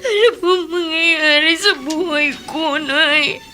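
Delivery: ordinary talk, nothing marked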